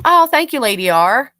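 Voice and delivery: ordinary talk, nothing marked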